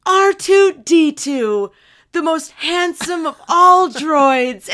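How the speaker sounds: loud, high, slow grand announcement; announcing droid voice. Retold or imitated. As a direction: proclaim it like a proud robot host. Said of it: "And she said".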